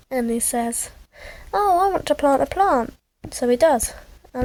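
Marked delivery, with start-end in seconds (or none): 1.5-2.9 high, lilting character voice; lightly acted, high-voiced eagerness; eager wish. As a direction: pitch it up as a keen little character.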